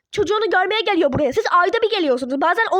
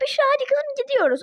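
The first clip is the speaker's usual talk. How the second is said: higher sing-song mimic of her mother's call; mock the mother's casual summons; 7 semitones higher than usual. Daughter mimicking her mother calling her. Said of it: "istediğiniz zaman da gelip".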